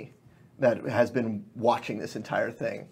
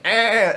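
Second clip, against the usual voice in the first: goat bleat; 10 semitones higher, 9 dB louder than usual. Acted. Camera passes under dire goat.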